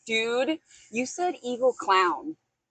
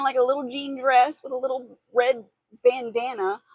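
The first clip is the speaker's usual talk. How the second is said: usual speaking voice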